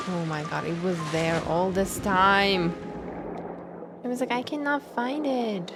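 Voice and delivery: ordinary talk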